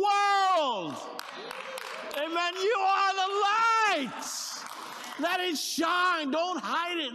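loud, high, slow drawn-out proclaiming; full-force altar call; preaching at full force. Real, not acted. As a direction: preach it out to the congregation. Sermon climax shouting.

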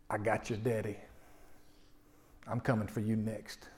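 a hushed threat from the dark. Retold or imitated. Preacher voicing the dark voice on the stairs, low and menacing. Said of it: "this dark voice spoke to me".

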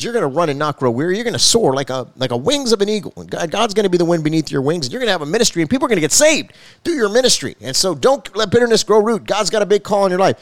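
lightly voiced stranger rising into a prophecy; retelling a stranger's prophecy, building fervour; preaching prophecy.

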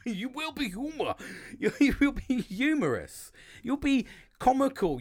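cracking up at his own fumbled word; laughing. Real, not acted.

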